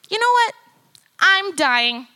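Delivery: high and loud, mock-dramatic; hammed-up cry of doom; dramatic despair. Retelling her certainty she was dying, loud and hammed-up.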